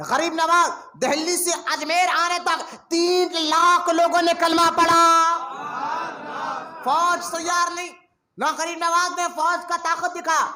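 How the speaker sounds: high, slow declaiming; impassioned preaching. Real, not acted. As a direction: stretch each phrase out to the crowd. (اونچی، آہستہ خطیبانہ ادائیگی)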